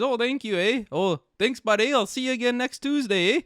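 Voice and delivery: hammed-up Canadian accent, high and sing-song. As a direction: overdo the friendly Canadian with eh. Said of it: "Come to Canada".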